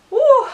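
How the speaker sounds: high, loud swooning whoop; short burst of delight, pitched high; excited woo. Real, not acted. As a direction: let out a fangirl "woo". Gushing over meeting the actor.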